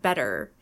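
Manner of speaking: better with a D-like T; flapped T. Shown as a demonstration. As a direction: say better as American "bedder". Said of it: "or a flapped T".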